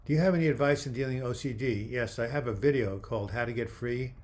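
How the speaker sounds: usual speaking voice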